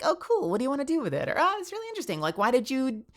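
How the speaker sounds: high, curious peer voice; lightly put-on enthusiasm; acted interest. Modelling an interested peer's reply.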